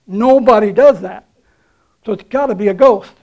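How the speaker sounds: loud, alarmed cry of frightened fishermen; fearful shout. Pretend. Preacher voicing the panicked fishermen, loud and alarmed. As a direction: shout it like you've seen a ghost.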